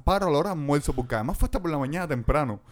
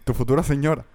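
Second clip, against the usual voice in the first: ordinary talk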